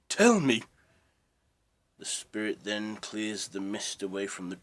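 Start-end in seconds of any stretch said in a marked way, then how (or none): 0.1-0.6 much higher and louder desperate cry; desperate shout. Acted. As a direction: scream the plea at the spirit. Scrooge begs the spirit twice.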